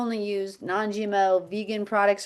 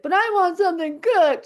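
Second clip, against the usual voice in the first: put-on high, louder whine of a child; whiny child; 10 semitones higher, 5 dB louder than usual. The son begs his mother for cooked food, whining. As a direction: whine like a tired kid. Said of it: "And he's like".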